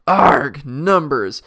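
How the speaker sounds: loud disgusted outburst; the king recoils at the word numbers; Azaz growling in disgust. Acted. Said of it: "growled Azaz disgustingly".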